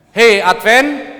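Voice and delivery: loud, high, drawn-out hail; calling out. Acted. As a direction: call someone by name to warn them. Calling out as the Lord.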